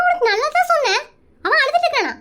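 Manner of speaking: normal talking voice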